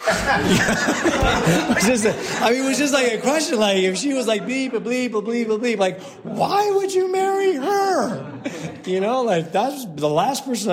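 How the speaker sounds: laughing hard, voice high and shouting. Real, not acted. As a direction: crack up while you get the words out. Laughing at his own blunt question.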